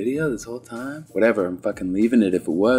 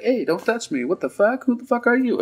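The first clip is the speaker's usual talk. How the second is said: put-on high, squeaky panicked old lady; mock confused alarm; 13 semitones higher than usual. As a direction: recoil from a stranger in a high voice. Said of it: "And she's like".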